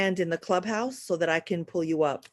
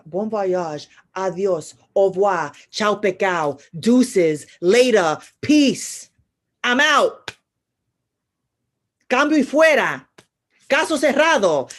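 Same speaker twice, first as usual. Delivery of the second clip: each farewell punchier than the last; string of goodbyes; 7 dB louder than usual. Real, not acted. Signing off to Sag, building to loud calls. Rapid-fire goodbyes in many languages.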